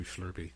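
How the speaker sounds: "Slurpee" said softly as "Shlurpee"; child's pronunciation. Retold or imitated. Dad shows how his son says it, "Shlurpee". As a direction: add an H after the S in "Slurpee". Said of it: "throws an H in there".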